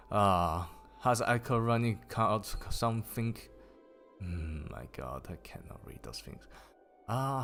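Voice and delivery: ordinary delivery, nothing marked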